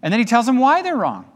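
ordinary talk, nothing marked

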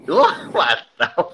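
ordinary talk

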